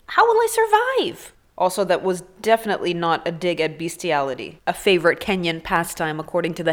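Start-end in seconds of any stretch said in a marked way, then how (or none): 0.1-1.3 very high, put-on plaintive voice; hammed-up high-pitched despair; mock helpless wail. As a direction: wail it like a damsel in distress. Mock helpless plea after joke.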